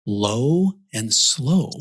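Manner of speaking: drawn-out, weighty words with gaps. Shown as a demonstration. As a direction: stretch each word out slowly. Acts out how brisket is cooked.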